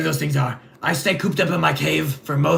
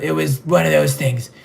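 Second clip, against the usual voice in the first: ordinary delivery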